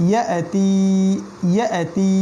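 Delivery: ইয়াতি with a catch after "ya" and a long "tee"; drawn-out vowel. Shown as a demonstration. Teacher repeats for learners, stretched last syllable of ইয়াতি. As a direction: hold the end of ইয়াতি long. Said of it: "এখানে আবার টান দিতে হবে".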